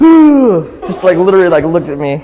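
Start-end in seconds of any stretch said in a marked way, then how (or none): 0.0-0.8 high loud startled ooh; Dustin's scared ooh. Retold or imitated. Imitating Dustin's scare, a loud high ooh. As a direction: yelp ooh like a spooked friend. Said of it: "He was like".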